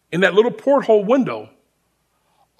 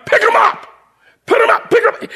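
much higher, louder rapid commands; imitated drill sergeant shout; 13 semitones higher, 4 dB louder than usual. Sergeant drilling the recruit with bags, imitated shouting. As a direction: hammer the orders one after another.

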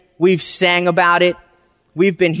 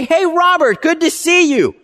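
friendly greeting; 8 semitones higher than usual. Retold or imitated. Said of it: "owners of the store say".

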